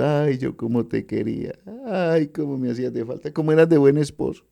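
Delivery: mock wailing widow. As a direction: imitate a mourner crying over the coffin. Mocking mourners at a coffin. (lamento burlón de viuda)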